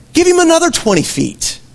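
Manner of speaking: high, lifted mock-shocking quote; quoting Jesus. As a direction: deliver it like a punchline. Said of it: "Jesus said".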